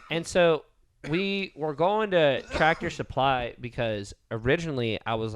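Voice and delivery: normal talking voice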